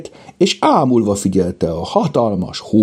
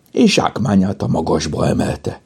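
ordinary delivery

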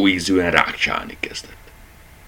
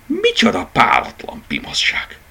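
higher, indignant exclamation; a touch of outrage; 13 semitones higher than usual. A narrator's reading. Dachshund fumes at the rabbit, mildly outraged. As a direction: fume at the cheek of it. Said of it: "egyre mérgesebb lett".

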